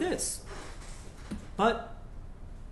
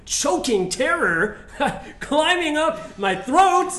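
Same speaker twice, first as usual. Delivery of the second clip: slow, high, louder words broken by nervous laughter; nervous laughing; 9 semitones higher, 9 dB louder, slower than usual. Acted. Husband confesses his terror of fatherhood, laughing nervously. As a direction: laugh through the fear as it spills out.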